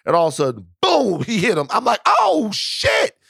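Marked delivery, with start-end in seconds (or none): 2.1-3.2 high-pitched startled oh shit; shocked exclamation. Retold or imitated. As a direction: blurt it out, caught off guard, much higher. Sees cousin punch a guy.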